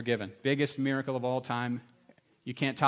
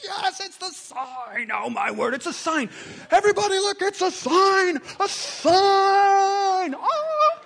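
much higher, louder hammed-up shouting; mock excitement; 7 dB louder, much slower than usual. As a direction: shout it to everyone like a lunatic. Mock roadside sign excitement.

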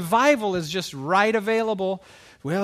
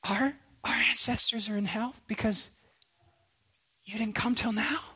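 higher, softer, halting voice of acted hurt; hushed dismay; 3 semitones higher, 6 dB quieter than usual. Converts grieving lost ancestors to late-coming missionaries, hushed dismay. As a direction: ask it softly, stunned and wounded.

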